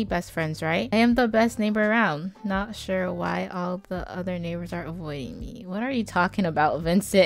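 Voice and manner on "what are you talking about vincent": ordinary talk, nothing marked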